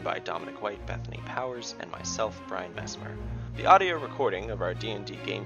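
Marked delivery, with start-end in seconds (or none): none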